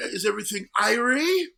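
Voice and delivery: high, overdone fake-Jamaican question; mock an American's clumsy patois; bad Jamaican accent. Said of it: "Irish pirate version of their accent".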